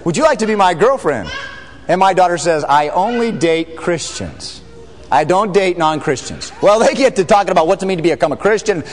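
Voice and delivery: normal talking voice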